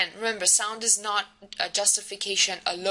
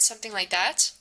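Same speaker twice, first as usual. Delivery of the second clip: normal talking voice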